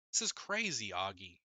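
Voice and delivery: firm and emphatic, pitch jumping up on "crazy". A narrator's reading. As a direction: lean hard on "crazy", then drop on "Augie". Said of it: "I said emphatically".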